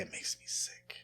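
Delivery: whispered disgust, barely audible; under her breath. Retold or imitated. Aunt whispering her disgust at the radio, under her breath. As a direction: hiss it under your breath so only he hears. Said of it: "And under her breath".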